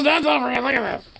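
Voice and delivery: very high squeaky pet voice; voicing the pet. Owner voicing the pet as a squeaky creature. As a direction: speak for the pet in a tiny voice. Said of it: "And he just goes".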